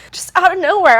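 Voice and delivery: ordinary talk, nothing marked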